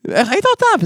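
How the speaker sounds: high, incredulous mock outrage; shocked question. Brother to sibling, voiced high in shocked disbelief. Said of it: "ואז הוא אומר לי".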